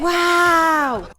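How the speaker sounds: long, high, loud sarcastic wow; mock amazement. Viewer mocking characters impressed by a smoke trick, mock amazement. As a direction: stretch it out in exaggerated fake awe.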